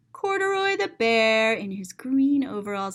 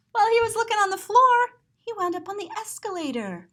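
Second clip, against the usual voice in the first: gasp, then higher, louder and fast; gasping surprise; 10 semitones higher, 5 dB louder, faster than usual. A narrator's reading. Reader reacting to the bear stepping onto the escalator, startled. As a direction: burst out as if startled. Sudden alarm over escalator.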